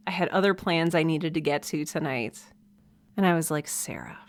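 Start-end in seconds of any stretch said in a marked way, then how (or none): 3.7-4.2 a sudden unvoiced whisper of her own name. Whispering her own name to scold herself. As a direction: hush it, like catching yourself in the act.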